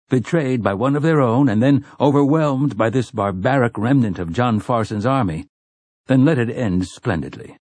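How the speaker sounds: ordinary delivery, nothing marked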